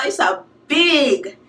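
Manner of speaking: ordinary delivery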